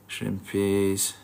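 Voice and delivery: normal talking voice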